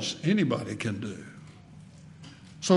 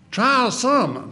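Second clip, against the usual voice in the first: put-on high, drawn-out South Carolina accent; slow South Carolina preacher's accent, pitched up; 8 semitones higher, 4 dB louder, slower than usual. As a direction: quote him in an old South Carolina drawl. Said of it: "had that South Carolina accent".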